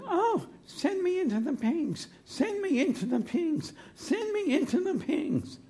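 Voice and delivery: very high-pitched little demon voice; pleading little demon. Acted. Little demon begging Jesus, high pleading voice. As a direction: beg in a tiny, high put-on voice. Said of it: "This demon, who pretends to be many".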